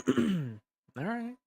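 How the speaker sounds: high meowy cat voice; cat impression. Shown as a demonstration. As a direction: meow it like a little cat. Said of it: "Cat impression?".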